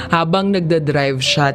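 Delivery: usual speaking voice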